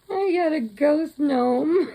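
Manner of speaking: high, loud words through laughter; can't stop giggling at the gift; laughing. Real, not acted. Opening Neva's swap gift, laughing out loud.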